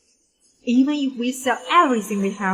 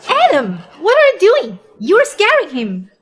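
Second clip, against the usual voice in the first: alarmed shout; 6 semitones higher, 8 dB louder than usual. Acted. Wife yells at her husband.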